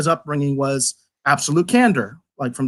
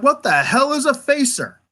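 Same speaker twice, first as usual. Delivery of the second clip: higher-pitched, mock-baffled question; retold bafflement; 8 semitones higher than usual. Reviewer recalling his reaction to the dialogue, retold bafflement.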